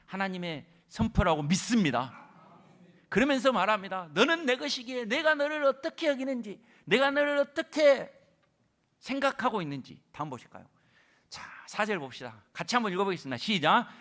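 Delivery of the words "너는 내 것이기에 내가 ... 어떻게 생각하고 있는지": God speaking tenderly yet loud. Acted. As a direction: lift up God's words. (높고 힘있게 전하는 하나님의 말씀)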